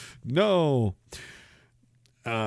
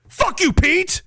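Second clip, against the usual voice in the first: high, loud mock shout; mock yell; 4 dB louder, much slower than usual. Streamer yells at an imagined friend, hammed up. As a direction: fling it at him.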